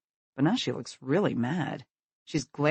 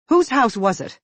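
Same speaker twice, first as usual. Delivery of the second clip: loud, higher sharp demand; 9 semitones higher, 8 dB louder than usual. Acted. Angry mother demands to know whose house, loud and sharp. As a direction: insist on the name. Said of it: "she demands now".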